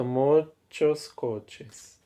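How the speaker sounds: very slowly spelling out "Muchos coches"; slow teaching demo. Shown as a demonstration. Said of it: "teacher models the plural slowly".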